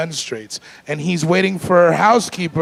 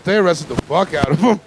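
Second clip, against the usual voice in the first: ordinary talk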